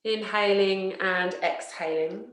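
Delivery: slow, measured yoga nidra voice with long pauses; guided relaxation. Acted. Teacher settling listeners into yoga nidra, slow guided relaxation. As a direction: guide them unhurriedly into rest, leaving space after each phrase.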